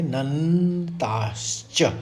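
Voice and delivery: "नन्ताश्च" drawn out slowly, lingering on the doubled न. Shown as a demonstration. Teacher explains the stress on न, "नन्ताश्च" with a long doubled न. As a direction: hold the न in "नन्ताश्च". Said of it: "दो स्वर रहेंगे".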